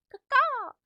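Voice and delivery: high loud cawing bird call; crow caw. Pretend. Greeting a bird-named viewer, loud high crow caw.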